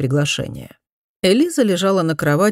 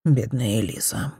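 lower, much softer sighed words; sighing pity; 5 semitones lower, 5 dB quieter than usual. A narrator's reading. Cousin alone, sighing in pity for her, low and soft. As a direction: breathe it out with pity after the door closes. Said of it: "она вздохнула".

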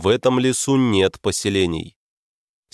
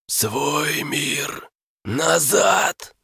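eldritch horror; 6 semitones higher, much slower than usual. Acted. Monster answers.

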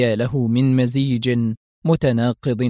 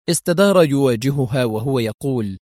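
ordinary delivery

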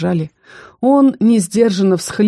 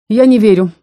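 louder, ringing disbelief; ringing denial; 4 semitones higher, 7 dB louder than usual. A narrator's reading. Son refusing to believe dean about his mother, ringing loud. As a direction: fling the denial out loud and clear. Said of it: "звонко разнеслось по кабинету".

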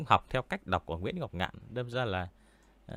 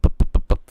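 loud unvoiced puffs of breath, popping p's; blown plosives; 15 dB louder than usual. Shown as a demonstration. Showing viewers how breath pops hit a mic without a filter, loud breathy puffs. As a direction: blast breathy pup pup pup straight into the mic. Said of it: "hay bị thổi pup".

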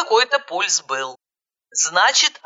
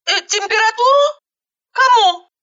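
very high, frantic questioning; bewildered; 4 dB louder than usual. Acted. Caller baffled by the dispatcher's question, bewildered. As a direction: squeal the question in disbelief.

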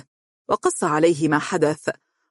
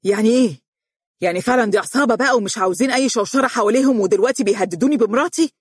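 agitated husband crying out, higher and rushed; exclaiming; 6 semitones higher, faster than usual. A narrator's reading. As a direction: burst out, racing and shocked. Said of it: "وهو يهتف".

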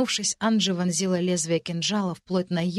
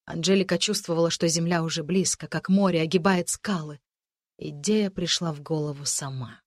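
usual speaking voice

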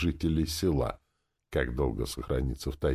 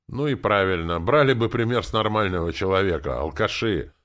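scolding housewife, louder and higher; reproachful; 5 semitones higher, 5 dB louder than usual. A narrator's reading. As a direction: reproach them in a raised voice. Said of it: "с упрёком посмотрела на зятя с тестем хозяйка". (с упрёком, громко отчитывая)

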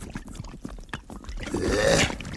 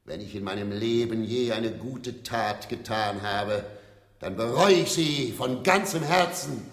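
stage villain monologue; 5 semitones higher than usual. Acted. Actor on stage delivering a villain's defiant speech. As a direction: play the unrepentant villain, proud of his crimes.

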